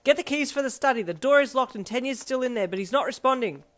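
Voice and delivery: loud, high shouted orders; yelled alarm, sustained at full volume; urgent hollering. A narrator's reading. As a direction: holler instructions in a panic. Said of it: "he hollered as he tore down".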